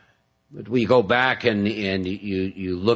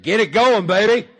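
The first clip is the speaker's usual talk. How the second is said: higher and louder, revved-up buzz; a jittery craving egging him on at dawn; hyped caffeine voice; 9 semitones higher, 4 dB louder than usual. Acted. The nicotine voice urging him awake, higher and louder. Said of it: "caffeine voice, nicotine voice said".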